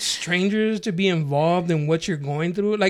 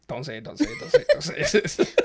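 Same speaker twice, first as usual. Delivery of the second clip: laughing mock self-restraint; imitated inner voice; 9 semitones higher than usual. Acting out guys' thoughts when friends mention surgery, imitated inner voice. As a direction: act out a frantic silent plea, breaking into laughter. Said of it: "in their head, they're like".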